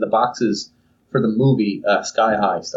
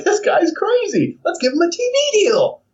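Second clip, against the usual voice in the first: excited producers. Acted. Onlookers deciding to give Joseph a show, gleeful. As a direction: pitch the TV deal with delight. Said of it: "and they're like".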